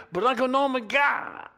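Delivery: high, loud protest cut off short; protesting Pee Wee. Retold or imitated. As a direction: blurt it out high before the hit. Pee Wee starts to curse.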